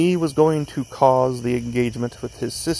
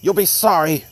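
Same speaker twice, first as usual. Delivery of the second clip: raised-pitch, menacing warning; angry threat; 5 semitones higher, 4 dB louder than usual. A narrator's reading. Bellingham threatening young Lee in their quarrel, angry threat. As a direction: throw the threat after him. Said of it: "he hissed".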